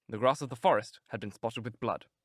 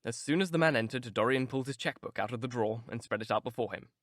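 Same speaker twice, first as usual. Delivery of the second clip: ordinary delivery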